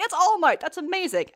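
high-pitched imitation of awed onlookers; amazed crowd. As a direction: cry out like a thrilled fan.

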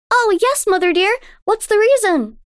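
put-on high, wondering child; a little girl full of awe; young girl voice. A narrator's reading. Daughter tells her mother about the moonlit lake, in wonder.

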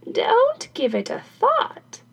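put-on high, slow old woman's voice; dismissive old crone. Crone dismisses carpenter's warning, high slow voice. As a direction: wave it away in a high drawl.

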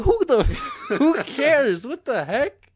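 high-pitched, loud incredulous outburst; exasperated disbelief. Retold or imitated. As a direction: react as if utterly baffled, voice shooting up. Acting out exasperated reaction to recording.

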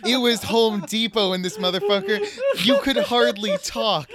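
indignant rant cracking into laughter; laughing mock rant. Host scolds Matt for eating, breaking into laughter. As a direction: scold him but lose it laughing.

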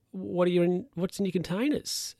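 high, fussy guard voice; security guard voice. Retold or imitated. Guard questioning the ladies' lunch, high and fussy. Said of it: "the guy's like".

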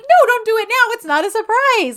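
very high, loud mock whine; hammed-up drunken petulance; petulant protest. Drunk woman refuses his late gifts, petulant mock protest.

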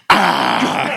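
exaggerated satisfied gasp after a drink; imitated refreshed "ahh". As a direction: mimic a soda-commercial "ahh". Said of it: "like a face explosion".

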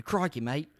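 amateur Australian accent, a catchphrase; showing off a rough Australian accent; bad Australian accent. Shown as a demonstration. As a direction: put on a not-so-good Australian accent. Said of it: "tried to do an Australian accent before".

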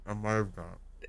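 chewing, muffled; mouth full. Real, not acted. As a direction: mumble through a bite. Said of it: "Oh my God".

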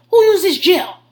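loud, high outraged demand; imitating a man yelling over gel. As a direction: holler an accusation at the whole house. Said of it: "He's yelling at everyone".

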